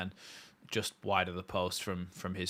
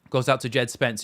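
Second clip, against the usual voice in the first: lively raised commentary; excited; 6 semitones higher, 10 dB louder than usual. Real, not acted. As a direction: call the attack with energy. Commentating a Leeds attack.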